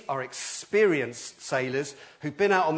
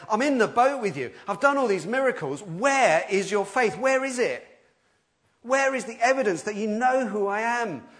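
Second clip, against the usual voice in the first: mild challenging rebuke; 8 semitones higher, 5 dB louder than usual. Retold or imitated. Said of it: "Jesus is turning to his disciples and saying".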